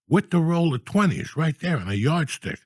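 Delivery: normal talking voice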